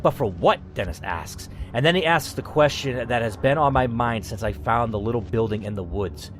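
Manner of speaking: steady storyteller read of the game text; story narration. Acted.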